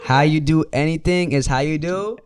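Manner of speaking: loud, slow, every word weighted. Shown as a demonstration. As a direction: proclaim it to the back row. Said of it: "Say that for the people in the back".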